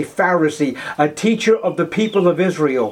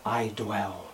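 starts quick, then sinks much softer to a breath; Jesus confiding to Nicodemus at night, fading soft; fading hush; 4 semitones lower, 6 dB quieter than usual. Acted. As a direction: let the words die away into a hush. Preacher voices Jesus to Nicodemus.

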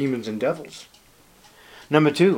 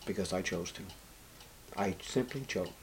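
soft, low, hushed answer; hushed voice of God; 6 semitones lower, 17 dB quieter than usual. Acted. Preacher quietly voicing God's answer to believers. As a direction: give God's final word quietly.

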